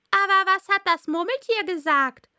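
high, loud juror voice; fragender Geschworener. Acted. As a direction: break in loudly with a high-pitched question. Juror breaks in with question. (laute, hohe Geschworenenstimme)

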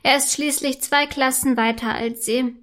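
ordinary delivery, nothing marked

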